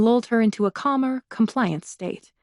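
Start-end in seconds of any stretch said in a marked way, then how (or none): none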